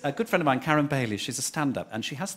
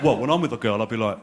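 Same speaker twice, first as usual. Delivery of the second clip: laddish boast; 3 semitones lower, 5 dB louder than usual. Retold or imitated. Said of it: "He's like".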